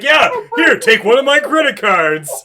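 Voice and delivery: high, loud mock generosity; hammed-up eager offer. Game master voicing a player showing cards, hammed-up and loud. Mocking the character handing over cards.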